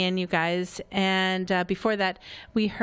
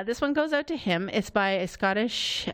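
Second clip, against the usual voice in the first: normal talking voice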